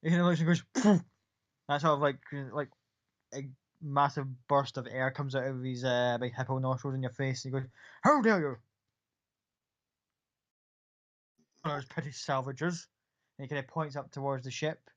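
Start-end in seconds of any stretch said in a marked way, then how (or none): 0.8-1.1 big loud nostril blast; hippo snort. Acted. Gith snorts air through nostrils. 8.0-12.9 a proud soldier insulted; offended Gith soldier. Acted.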